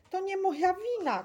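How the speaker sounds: high, loud protest; desperate driver shouting in self-defence; hoarse shout. A narrator's reading. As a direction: cry out your innocence. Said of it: "krzyknął schrypniętym głosem".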